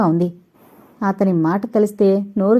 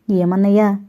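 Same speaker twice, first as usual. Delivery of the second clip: ordinary delivery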